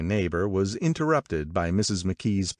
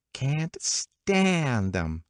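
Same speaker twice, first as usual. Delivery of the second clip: "stand" hugely drawn out and high; emphatic gossip; 8 semitones higher, much slower than usual. A narrator's reading. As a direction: stretch "stand" slowly for relish. Gossip dwells on the word stand.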